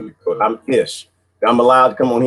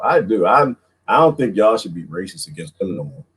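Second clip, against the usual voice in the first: normal talking voice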